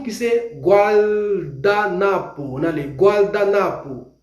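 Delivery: usual speaking voice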